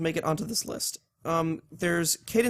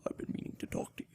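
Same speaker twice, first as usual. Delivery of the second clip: breathy whispered villain line; Javier Bardem whisper; 15 dB quieter, faster than usual. Retold or imitated. Imitating Bardem's No Country killer, a menacing whisper. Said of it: "No Country for Old Men accent that Javier Bardem does".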